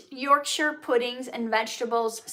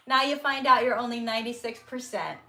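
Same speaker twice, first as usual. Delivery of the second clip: normal talking voice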